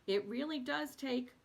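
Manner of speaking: steady, measured reading of a book chapter; calm expressive reading voice; reading aloud. Acted. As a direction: read it to listeners like an audiobook.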